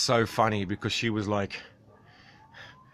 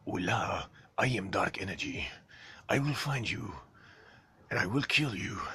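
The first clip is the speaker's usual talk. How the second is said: menacing villain; 6 dB quieter than usual. Pretend. Reading a viewer's threat as a sinister villain. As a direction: read it like a movie villain.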